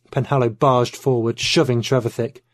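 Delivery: usual speaking voice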